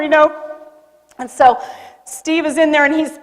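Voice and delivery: normal talking voice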